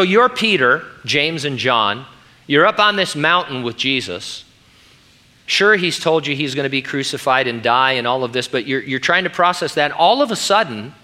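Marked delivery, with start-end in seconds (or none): none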